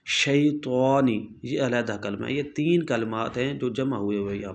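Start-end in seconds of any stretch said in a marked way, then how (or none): 0.1-1.2 very slow "शैतानी", every syllable separated; slow demo word. Shown as a demonstration. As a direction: spell out "शैतानी" by sounding it slowly.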